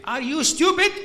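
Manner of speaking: exasperated shout, pitched high and loud; re-enacted shouting. Counselor losing patience with a weeping questioner, shouting. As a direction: burst out in a high, incredulous yell. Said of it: "And I start shouting".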